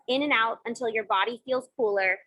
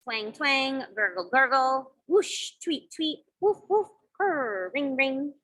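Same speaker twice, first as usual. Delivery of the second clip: imitated everyday sounds; slower than usual. Shown as a demonstration. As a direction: act out each sound word playfully. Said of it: "count how many different sounds you can hear".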